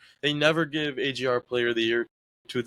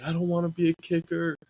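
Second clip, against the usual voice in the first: retold complaint, pitched up high; mock protest; 7 semitones higher than usual. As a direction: imitate your younger self protesting, voice lifted. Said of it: "I was like".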